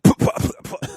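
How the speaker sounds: mock choking on water, sputtering puh sounds. Nearly choking on water after seeing the lost quad, sputtering. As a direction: sputter like water went down wrong. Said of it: "almost choke on my water".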